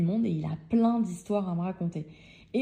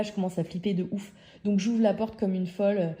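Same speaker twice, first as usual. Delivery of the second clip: ordinary talk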